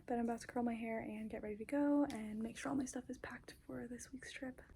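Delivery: soft unvoiced whisper. Real, not acted. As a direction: whisper so a sleeper stays asleep. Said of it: "I'm in the closet whispering".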